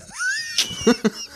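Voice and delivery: a squeaking high laugh; high burst of laughter. Real, not acted. Host cracking up at his own silly question, high laugh. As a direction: break into giggles at your own question.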